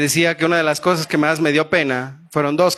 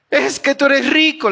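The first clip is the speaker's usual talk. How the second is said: put-on envious old aunt; 10 semitones higher than usual. Aunt's quote, voiced higher.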